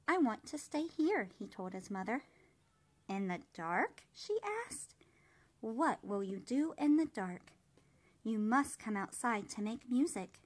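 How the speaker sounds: put-on gentle, even read-aloud voice for children; storybook reading. A narrator's reading. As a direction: read it like bedtime story time. Reads picture book aloud.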